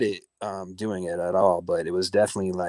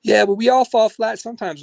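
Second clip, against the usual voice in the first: high, louder mocking voice; 11 semitones higher, 7 dB louder than usual. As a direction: toss it off in a higher mock host voice. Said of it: "And he's like".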